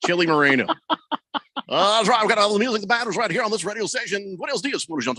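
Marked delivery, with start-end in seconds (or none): none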